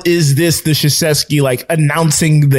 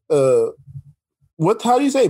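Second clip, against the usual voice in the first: ordinary talk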